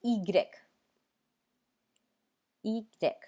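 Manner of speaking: ईग्रेक said ee-grek, ending on a falling tune; French letter Y. Shown as a demonstration. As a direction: pronounce ईग्रेक in careful French. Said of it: "वाई का उच्चारण होता है ईग्रेक".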